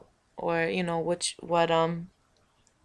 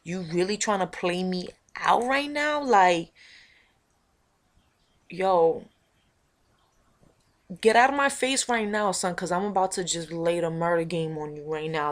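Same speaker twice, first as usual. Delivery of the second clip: loud threatening street voice; tough street girl; 6 dB louder than usual. Acted. Telling the old homegirl to get out of her face, loud and tough. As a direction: warn her off hard. Back to her own character.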